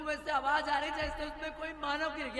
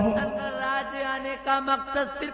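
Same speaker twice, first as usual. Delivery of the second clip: loud villain declaration; 2 semitones higher, 9 dB louder than usual. Acted. Villain reveals her plot loudly.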